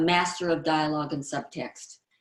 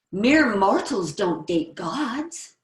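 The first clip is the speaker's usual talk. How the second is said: very slow, louder, lofty pronouncement; grand compliment; 4 semitones higher, 5 dB louder, much slower than usual. Acted. Complimentary girl flattering a boy grandly and slowly. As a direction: declaim it like praising a god. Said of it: "a complimentary trait".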